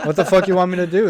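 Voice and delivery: mock fed-up retort; exasperated. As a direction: snap back at him, hammed-up. Retold exasperated reply.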